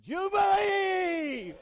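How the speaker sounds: high, drawn-out, ringing shout; jubilant loud shout. Shown as a demonstration. Preacher showing the congregation how jubilee should sound, loud jubilant shout. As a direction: cry it out long and loud, far above speaking pitch. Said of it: "so we get a loud shout".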